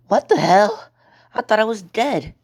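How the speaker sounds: high-pitched, bewildered exclamation; startled shock. Acted. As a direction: burst out as if jolted back to life. Revived girl is shocked.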